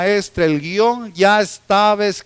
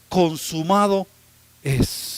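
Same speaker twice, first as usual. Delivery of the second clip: ordinary talk, nothing marked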